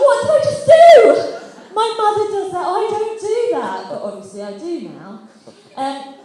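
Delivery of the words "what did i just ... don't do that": high, loud shocked squeal. Retold or imitated. Staring in the mirror, shrieking in horror at herself.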